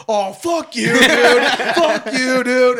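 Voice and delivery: loud, high imitation of an angry outburst; mock angry yell. Retelling a stranger's outburst at being told kids are here, mock yell. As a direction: yell it as the riled-up stranger in the story.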